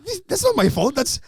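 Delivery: high-pitched mock defensiveness; indignant protest. Comedian denying blame to the old man, indignant protest.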